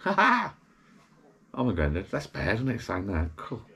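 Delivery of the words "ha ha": high burst of laughter. Real, not acted. Laughs at calling himself granddad.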